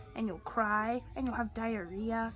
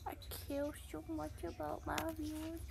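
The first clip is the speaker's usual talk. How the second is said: normal talking voice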